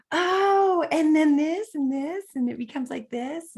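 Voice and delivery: high, breathless burst of ideas; excited inspiration. Retold or imitated. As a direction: act out ideas tumbling in with delight. Acting out creative excitement at beauty.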